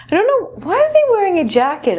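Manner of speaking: high, fast mock bafflement; playfully incredulous question; mock puzzled. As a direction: act baffled that potatoes wear jackets. Joking about jacket potatoes.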